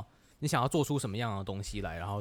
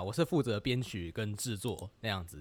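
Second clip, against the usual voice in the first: ordinary talk, nothing marked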